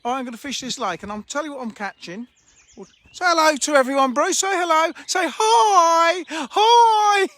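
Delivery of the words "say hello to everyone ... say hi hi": high, loud greeting call. Real, not acted. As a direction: holler a drawn-out hello, pitched right up. Calling out a greeting to Bruce.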